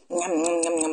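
loud playful munching noises; munching njam njam. Acted. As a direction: smack the lips like a hungry rabbit. Rabbit voiced munching.

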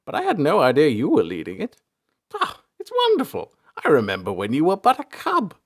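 put-on old man's voice, swooping up high with delight; old elder, delighted and nostalgic. A narrator's reading. Elder learns his former cub leads the investigation, delighted. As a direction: reminisce excitedly, pitch leaping upward.

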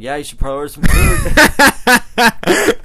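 loud, slow, sly hint dragged out; mock innocent suggestion. Nudging his hungry friend to order food, slow sly tease.

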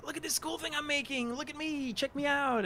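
very high, attention-seeking show-off voice; mock self-promoter. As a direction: beg for attention in a squeaky-high parody. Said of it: "sell myself and be like".

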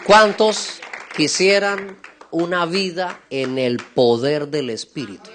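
loud, slow, drawn-out preacher's question; rallying call. Real, not acted. Preacher asks the congregation who wants this life, rallying call. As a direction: ring it out slowly to the whole hall.

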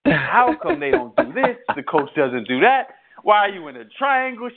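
heated fan complaining to his wife about the coach; ranting at the TV. Retold or imitated. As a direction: vent like a fan yelling at a losing team. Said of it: "I'd be like".

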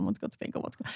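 very soft, voiceless mock grumble; muttered whinge. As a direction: repeat it under your breath like a pestering child. Child pestering all day at school.

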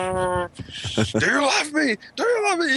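high, shouted, drawn-out do you love me; mock plea. Friend mocking the question to his wife, high shout. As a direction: wail it like a desperate lover.

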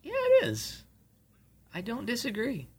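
squeaky put-on voice; high mock agreement. Mocking exaggerated agreement.

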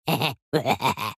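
short bright laugh. Real, not acted. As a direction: laugh it off loudly. Laugh after whisper.

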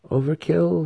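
high, lightly wondering query; offhand question. A narrator's reading. Student with crossbow aimed at spiders, offhand. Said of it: "he asked aloud".

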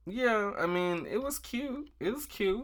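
high-pitched, hedging faint praise; lukewarm praise. Real, not acted. Host hedging on a recycled runway look, lukewarm praise.

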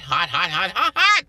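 hot hot hot, laughing, rising loud; laughing emphasis. Real, not acted. Describing Houston summer heat, laughing and rising.

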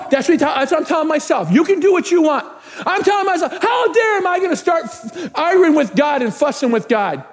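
high, emphatic self-rebuke; fiery preaching. Real, not acted. Pastor telling himself not to argue with God, fiery preaching. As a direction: scold yourself from the pulpit.

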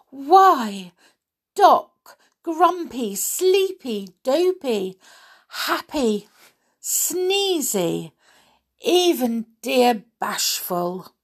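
high, delighted princess roll-call; joyful. A narrator's reading. Princess recognises her old friends at the door, joyfully. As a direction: greet each one as a lovely surprise. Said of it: "lit up with joy".

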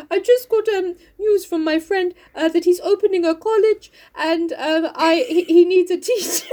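high, halting character voice; Mister Bear. Acted. Bear breaking his news to Jo, put-on voice. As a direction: pitch it up as the comic suitor. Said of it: "so he's like".

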